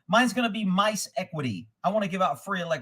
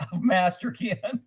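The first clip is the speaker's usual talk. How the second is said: laughing; 3 dB quieter, slower than usual. Real, not acted. Reading the book title, cracking up.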